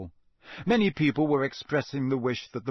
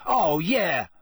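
higher, combative retort; yelling back; 4 semitones higher, 4 dB louder than usual. A narrator's reading. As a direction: throw it straight back, raised voice. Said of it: "Ron yelled back".

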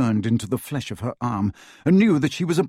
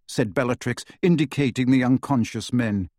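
ordinary delivery

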